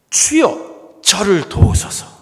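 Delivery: high, loud, desperate cry for help; preacher voicing the mother's loud begging cry; desperate plea. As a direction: cry out to the Lord as if a child's life depends on it. Canaanite woman begs Jesus for help.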